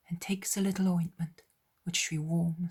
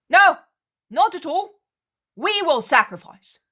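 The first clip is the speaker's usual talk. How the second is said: high, loud counter-shout; loud protest; 12 semitones higher, 14 dB louder than usual. Acted. Disciple shouting back at the raging Cretan. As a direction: shout back firmly over him.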